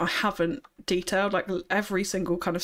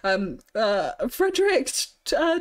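ordinary talk

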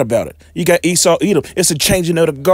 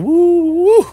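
high falsetto woo of delight; whooping high with glee; excited whoop. Real, not acted. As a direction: let out a joyful high hoot. Excited about the lesson.

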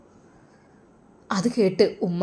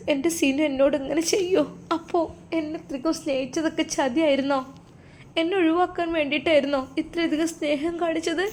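put-on higher and softer, hurt young wife; heartbroken; 7 semitones higher, 4 dB quieter than usual. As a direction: plead softly, wounded. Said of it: "മുഹ്സിനക്ക് വല്ലാത്ത സങ്കടം വന്നു". (ഉയർന്ന, മൃദുവായ, ദുഃഖിതയായ ശബ്ദം)

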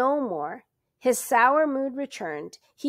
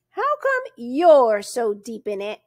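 slow, louder, probing distrust; suspicious; 8 dB louder than usual. A narrator's reading. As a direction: constable questions the stranger about the kidnapping, suspicious and slow. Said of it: "asked the constable suspiciously".

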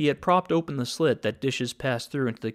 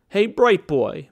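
mocking taunt; 6 semitones higher, 7 dB louder, slower than usual. A narrator's reading. Gangster taunting Nick.

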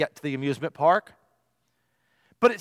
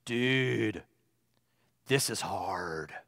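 much lower, slow and quiet stoner drawl; Keanu Reeves voice; 8 semitones lower, 9 dB quieter, much slower than usual. Retold or imitated. Student after class to his German teacher, in a low Keanu Reeves drone. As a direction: sink into a lazy, sleepy Keanu Reeves surfer voice. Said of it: "in his best Keanu Reeves impression".

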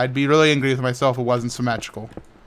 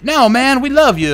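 friendly player; 9 semitones higher, 5 dB louder than usual. Retold or imitated. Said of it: "And they're like".

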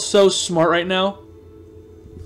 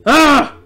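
short, high shriek of frustration; loud angry scream; 7 semitones higher, 5 dB louder than usual. Real, not acted. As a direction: burst out with a scream. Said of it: "you getting really loud".

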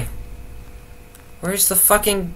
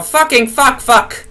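mock panic; 8 semitones higher, 4 dB louder, slower than usual. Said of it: "and I'm gonna go".

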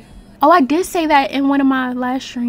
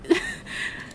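short, soft chuckle; amused laugh; 6 dB quieter than usual. Real, not acted. Player amused by her game character's voice, chuckling. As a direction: chuckle under the breath.

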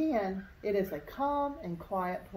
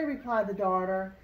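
plain reading voice

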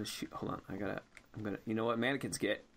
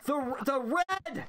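frustrated yelling; 11 semitones higher, much slower than usual. Real, not acted. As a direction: drag out the red in exasperation. Yelling at partner about button.

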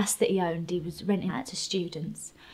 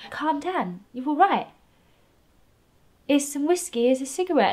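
high, louder imitation of a soothing man; mock-soothing captor; 7 semitones higher, 5 dB louder than usual. Captor offering whiskey to shaking victim, mock-soothing. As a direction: coax in a raised, lilting voice. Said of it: "then he went dead calm".

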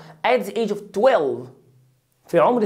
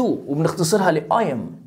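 ordinary delivery

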